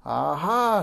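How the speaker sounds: loud, high witch's cry; shrieked, triumphant discovery; scream. A narrator's reading. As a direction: pounce on it with a screech. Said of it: "she screamed".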